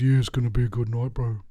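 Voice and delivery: mock flat South Island bloke, fast; unenthusiastic Kiwi. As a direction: imitate a bored Kiwi guy at a party. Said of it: "this guy from the South Island next to me goes".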